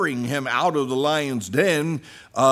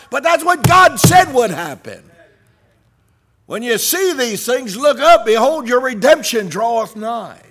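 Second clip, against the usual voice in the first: loud, higher, building shout; preaching at full force; 6 semitones higher, 7 dB louder than usual. Acted. Preacher rallying the congregation, shouting. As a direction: climb to a roaring climax.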